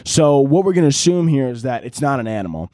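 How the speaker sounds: ordinary talk, nothing marked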